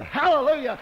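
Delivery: a high, loud, joyful hallelujah; excited hallelujah. Real, not acted. Preacher to his congregation, a high, loud, joyful hallelujah. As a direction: burst out in real praise. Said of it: "I can get excited about that".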